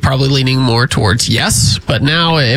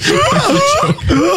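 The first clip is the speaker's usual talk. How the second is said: laughing high walrus bellow; walrus call. Pretend. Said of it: "do your walrus impression".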